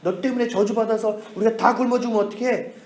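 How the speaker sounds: high-pitched mock berating; acted angry cursing. Desperate crowd curses the lone dissenter, acted high-pitched anger. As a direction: scold him as an outraged crowd would. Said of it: "사람들이 다 욕을 하는 거야".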